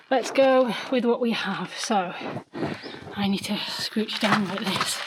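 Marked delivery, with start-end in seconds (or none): 3.2-5.0 whispering. Real, not acted. Crouching into cellar, whispering.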